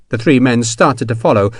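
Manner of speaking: ordinary delivery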